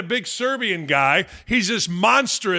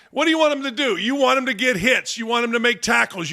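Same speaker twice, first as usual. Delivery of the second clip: ordinary talk, nothing marked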